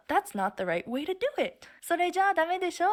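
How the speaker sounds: high-pitched acted scolding; mock correction. Acted correction in a retold exchange.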